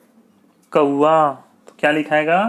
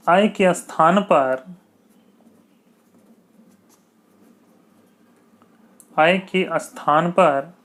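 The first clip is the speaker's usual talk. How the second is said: slow dictation; slower than usual. Shown as a demonstration. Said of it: "teacher dictates slowly".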